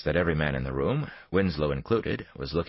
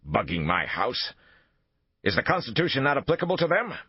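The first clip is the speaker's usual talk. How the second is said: indignant; 10 semitones higher than usual. A narrator's reading. Thornhill indignant outburst.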